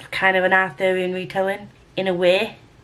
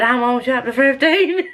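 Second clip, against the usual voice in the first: brief high Southern twang; put-on Southern accent; 5 semitones higher than usual. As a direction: slip playfully back into a mock Southern accent. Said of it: "Okay, I'm gonna stop now".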